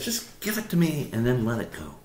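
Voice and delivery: soft, slowing, sinking to a hush; calm reassurance. Acted. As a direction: gently tell them to let go. God reassures, asks to let go.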